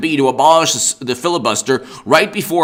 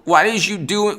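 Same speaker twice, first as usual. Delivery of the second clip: ordinary delivery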